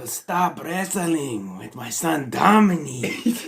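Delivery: slow, high mock Mexican accent, drawn out; hammed-up Mexican accent, very slow and high; Rey Mysterio accent. Asked to show off his Rey Mysterio accent, mock Mexican accent. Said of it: "Do your Rey Mysterio accent".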